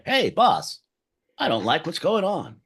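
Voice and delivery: a touch of a 1950s training film; chirpy old instructional narrator, lightly done; 1950s handbook voice. Pretend. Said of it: "books at that time have voices like that".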